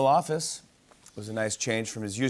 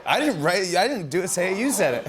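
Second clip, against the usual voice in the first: high, loud laughing denial; protesting; 8 semitones higher, 4 dB louder, slower than usual. Real, not acted. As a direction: deny it, giggling. Host protesting innocence, laughing.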